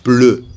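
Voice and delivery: "Bleu" with kiss-shaped lips on eu; French eu vowel. Shown as a demonstration. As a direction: model "Bleu" firmly with a rounded French eu. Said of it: "c'est la bouche bisou".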